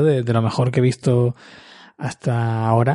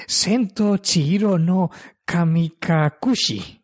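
slow, raised-pitch Japanese title reading; imitated Japanese; 5 semitones higher, slower than usual. Shown as a demonstration. Host reads out the film's title in Japanese, slow and deliberate Japanese. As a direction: pronounce it slowly in mock-careful Japanese. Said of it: "dicho en japonés".

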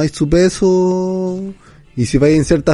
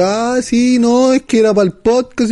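higher-pitched imitation of backing out; mock excuse; 6 semitones higher than usual. Friend mimics the other backing out of the trip, mock excuse.

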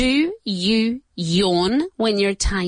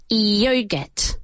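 ordinary talk, nothing marked

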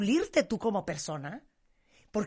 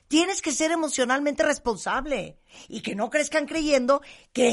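raised, forceful lecturing voice; heated scolding; 4 semitones higher, 7 dB louder than usual. Retold or imitated. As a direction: tell her off loudly and firmly. Retelling her heated advice to a friend.

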